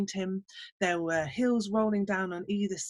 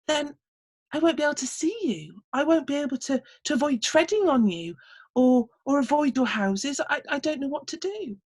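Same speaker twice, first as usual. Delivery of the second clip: higher, stammering worried farmer; flustered farmer; 6 semitones higher than usual. Acted. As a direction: fret helplessly over it. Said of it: "he said".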